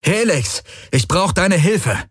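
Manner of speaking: high, slow call over the sirens. Acted. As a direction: call out to the ship, drawn out. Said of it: "Er hob die Stimme über die Kakophonie".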